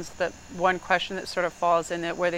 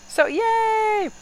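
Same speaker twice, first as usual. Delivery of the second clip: mock cheer; 4 dB louder than usual. Mock cheer after a complaint rant.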